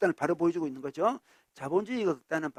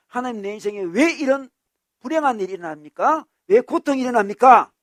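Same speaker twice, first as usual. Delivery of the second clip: loud, higher-pitched pleading complaint; resentful protest; 6 semitones higher, 10 dB louder than usual. Acted. Devout churchgoer asking God why suffering came, resentful protest. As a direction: cry out to God demanding why. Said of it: "하나님께 원망을 돌려요".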